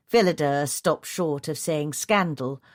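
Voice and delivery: ordinary delivery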